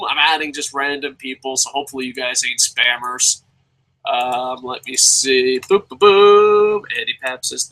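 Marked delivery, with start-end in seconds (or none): 5.6-6.8 slow, stretched ba-boom; ba-boom sound effect. Pretend. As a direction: sing out the sound cue. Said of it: "sound effect again".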